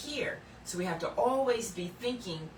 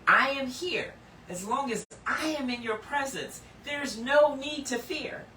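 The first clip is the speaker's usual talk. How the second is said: voicing God; 6 semitones higher, 4 dB louder than usual. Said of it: "But he's saying".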